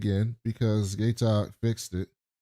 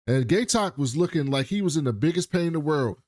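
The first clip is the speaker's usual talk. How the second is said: usual speaking voice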